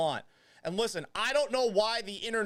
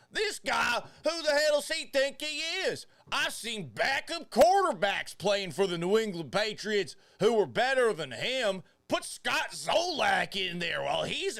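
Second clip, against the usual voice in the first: bad Texas accent; 7 semitones higher than usual. Host in character as Tex Gallant rages at the Texans' quarterback in a Texas accent. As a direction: rant like an outraged Texan fan, hammed up. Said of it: "Let me get in character".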